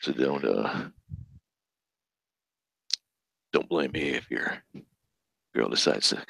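low, soft seductive voice; sexy voice. Pretend. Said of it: "we're gonna try and do this in a sexy voice".

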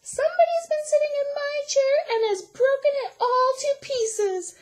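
very high, fast, wailing little squeal; Baby Bear voice. A narrator's reading. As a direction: wail it as a wee baby bear. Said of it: "said wee little Baby Bear".

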